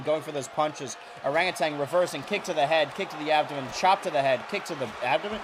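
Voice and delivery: usual speaking voice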